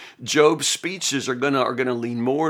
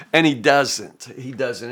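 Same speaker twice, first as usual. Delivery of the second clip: ordinary talk, nothing marked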